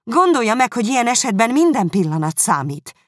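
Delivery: high, loud insistent begging; urgent pleading. A narrator's reading. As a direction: urge father to act now. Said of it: "komolyan kérlelni kezdte". (hangos, sürgető könyörgés)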